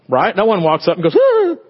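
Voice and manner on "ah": short high-pitched shriek; mock frightened scream. Joking to church that nobody fears babies, mock scream. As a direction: yelp in mock terror, high and loud. Mimes being scared of a baby.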